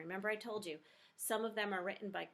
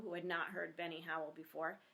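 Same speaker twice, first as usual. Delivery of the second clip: plain reading voice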